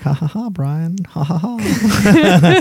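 high, put-on ha-ha laugh; mock laughter. Teasing friend mimics her reaction to Brian, high mock laugh. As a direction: imitate a fake, indulgent laugh, drawn out at the end.

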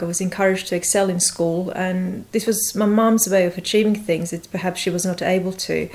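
ordinary delivery, nothing marked

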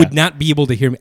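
normal talking voice

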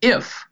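IF lifted high and drawn out; the word If set apart, high and lingering; stress on IF. Retold or imitated. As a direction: lean hard on the word If. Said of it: "we skip over the very first word".

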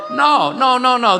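high, loud no no; emphatic refusal. Real, not acted. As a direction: shut it down firmly. Preacher rejects mocked complaint.

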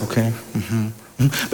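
low, soft acknowledgements; patient listener. Acted. Preacher as God hearing Elijah out, patient listening. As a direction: murmur along, letting them vent.

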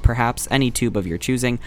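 ordinary talk

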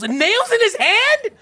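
high, loud appalled shriek; mock horrified child. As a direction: cry out in hammed-up disbelief. Children horrified by nails in hand.

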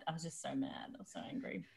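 soft unvoiced whisper. Real, not acted. Confessing her anger to friends in a whisper. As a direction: breathe the words out with no voice. Whispering about being angry.

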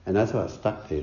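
ordinary delivery

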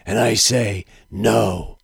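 deep, brooding noir narrator voice; low gravel vigilante growl. Retold or imitated. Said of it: "read dialogue from The Watchmen".

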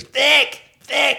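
high-pitched screech of a name; mock scream. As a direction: imitate a woman screaming for someone. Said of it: "She just starts screaming".

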